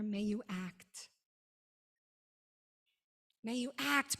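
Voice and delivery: ordinary talk, nothing marked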